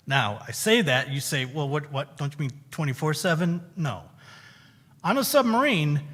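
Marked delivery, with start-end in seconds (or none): none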